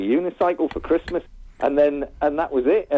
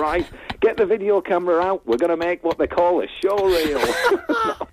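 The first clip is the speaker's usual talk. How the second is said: high, laughing mock shout across the farm; calling out to dad and Nicola, gleeful; retold excited shout; 7 semitones higher than usual. Lad on the farm calls his dad and girlfriend, mock shout. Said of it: "and I shouted".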